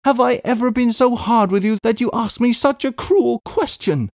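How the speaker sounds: high, wounded plea, acted; tearful reproach. A narrator's reading. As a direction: plead high, hurt by a brother's coldness. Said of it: "bursting into tears".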